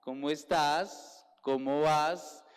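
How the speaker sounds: put-on slow, sing-song Bogotá accent greeting; mock the Bogotá accent, drawn out; imitating Bogotá accent. The girl mimicking how people from Bogotá greet, slowly. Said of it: "Ustedes dicen".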